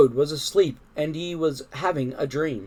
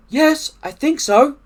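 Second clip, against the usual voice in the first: high, raised reply; Frog's voice; 11 semitones higher, 7 dB louder than usual. A narrator's reading. Frog reassures Toad after the dream, high voice. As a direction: answer in a high character voice.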